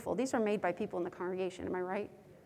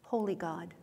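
whispered; 5 semitones lower, 6 dB quieter than usual. Acted. Opening the confession prayer.

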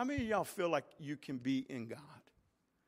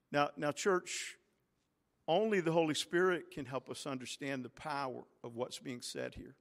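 normal talking voice

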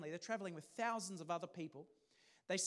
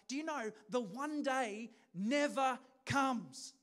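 emphatic preaching; 5 semitones higher, 5 dB louder than usual. Real, not acted. Preacher driving the point home.

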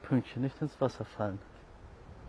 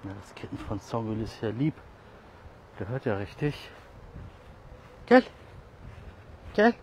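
ordinary talk, nothing marked

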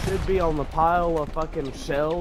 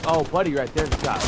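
normal talking voice

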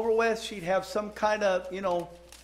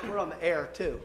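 usual speaking voice

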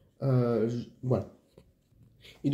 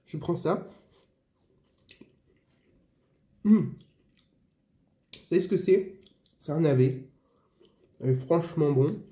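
eating between words; talking while chewing. Real, not acted. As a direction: mouth partly full. Seen 1: takes bites of turnip from fork, chews while talking.

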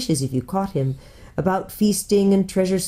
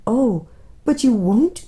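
slow, higher appeal; anxious pleading; 5 semitones higher, slower than usual. A narrator's reading. Lucy begging the faun, anxious. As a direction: stretch it out in dismayed disbelief.

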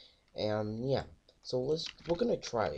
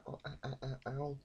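normal talking voice

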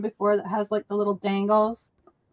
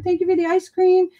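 a bright, repetitive game-character thank-you; lightly voiced TikTok NPC; 6 semitones higher than usual. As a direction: play a looping NPC line, mildly. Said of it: "pretends to be an N P C character".

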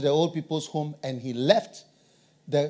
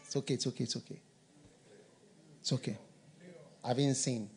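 much softer, hushed comfort; soft reassurance; 5 semitones lower, 14 dB quieter, faster than usual. Retold or imitated. God reassuring the sinner, hushed soft comfort. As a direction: murmur it gently like forgiving. Voicing God's grace.

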